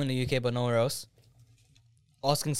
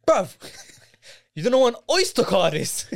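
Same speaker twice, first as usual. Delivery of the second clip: higher, louder imitation of an indignant friend; incredulous mock challenge; 7 semitones higher, 5 dB louder than usual. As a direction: act out cheeky disbelief at someone's ignorance. Acts out friend challenging bus driver.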